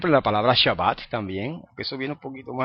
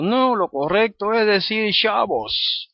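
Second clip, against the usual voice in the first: higher, slow, lecturing imitation; mock know-it-all brother; 9 semitones higher, 3 dB louder, slower than usual. Teacher mocking a brother insisting on Shabbos. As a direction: play a smug brother who corrects everyone. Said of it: "algún hermano que venga por ahí diciendo".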